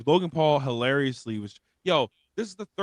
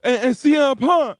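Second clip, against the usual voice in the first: mocking Logan Paul's put-on tough accent, higher and louder; mocking fake accent; 11 semitones higher, 4 dB louder than usual. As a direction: ham up the fake street accent.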